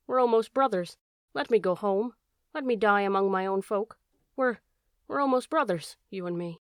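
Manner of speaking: ordinary delivery, nothing marked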